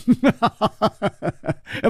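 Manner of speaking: high mad giggle; giddy cackle. Acted. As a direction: cackle like a lunatic. Madman laughs.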